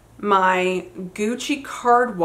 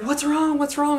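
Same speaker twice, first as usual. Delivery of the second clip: higher-pitched imitation of a concerned dad; mock alarm, voice jumping up; worried dad; 8 semitones higher than usual. Dad asking his crying child what happened, imitated high and worried.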